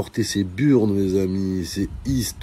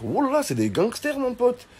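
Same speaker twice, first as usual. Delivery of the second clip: high-pitched rising alarm. Pretend. Realizing her brothers are gangsters, high alarm. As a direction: squeal it in panic.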